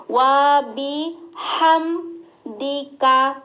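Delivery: ওয়া বি হাম দিকা syllable by syllable, very slow, breathy হা; slow recitation. Shown as a demonstration. Teacher showing the হা sound to students. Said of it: "এখানে কিন্তু critical হা আছে".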